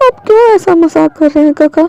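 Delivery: put-on increasingly high, desperate little girl voice; pleading child. Child begging her guardian not to leave, desperate.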